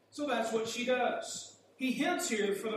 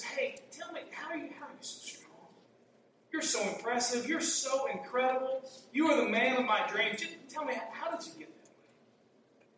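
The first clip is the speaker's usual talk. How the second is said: flirtatious Delilah; 4 semitones higher than usual. Pretend. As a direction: sweet-talk him like a flirt fishing for a secret. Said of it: "Was she kind of flirtatious?".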